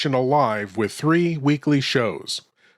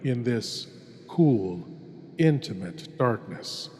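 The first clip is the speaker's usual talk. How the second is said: hushed horror host; 2 semitones lower than usual. Acted. Horror host introduces tonight's tale.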